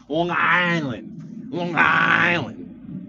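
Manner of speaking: very slow, stretched Long Island vowels; exaggerated Long Island accent. Shown as a demonstration. Showing how they add letters, drawn-out Long Island accent. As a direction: drag "Island" way out in Long Island parody. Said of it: "That's like the Long Island act".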